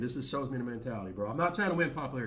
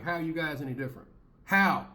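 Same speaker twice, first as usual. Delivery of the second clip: exasperated retort; 4 semitones higher, 7 dB louder than usual. Retold or imitated. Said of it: "I'm like".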